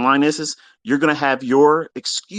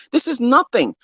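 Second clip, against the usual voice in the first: normal talking voice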